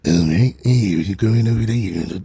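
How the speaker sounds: low mock British tough guy; Jason Statham. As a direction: drop the pitch into a British hard-man voice. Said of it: "Maybe some Jason Statham racism".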